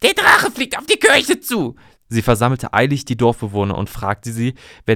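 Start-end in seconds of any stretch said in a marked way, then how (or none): 0.0-1.8 high, loud alarmed call to the village; frantic warning cry; panicked shout. Acted. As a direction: warn everyone, voice way up in panic. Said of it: "rief sie panisch".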